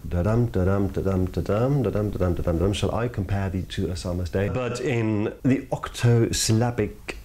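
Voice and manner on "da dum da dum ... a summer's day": da-dum, da-dum tapped out with the stress on each dum; iambic rhythm. Shown as a demonstration. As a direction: beat out the iambic pulse, weak then strong. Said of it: "in iambic pentameter".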